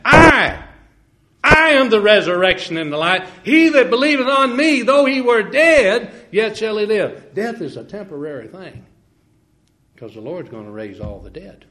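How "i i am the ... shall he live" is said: the I cried out high and loud; fervent, high emphatic I am. Shown as a demonstration. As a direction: thunder the I as Jesus. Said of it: "The emphasis, brethren, is on the I".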